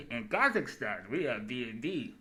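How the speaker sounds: slow, loud, lightly put-on Kazakh accent; a hammed-up foreign dignitary, drawn out slowly; a hint of a Kazakh accent, slow and loud; mock Kazakh accent. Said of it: "I forgot that accent wrong".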